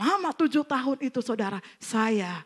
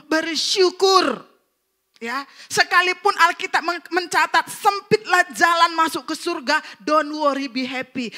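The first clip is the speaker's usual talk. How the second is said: exhortation building to high and loud; rally the congregation, growing louder; punchy, loud sermon delivery; rousing preaching; 5 semitones higher, 7 dB louder than usual. Real, not acted. Exhorts congregation with rising force.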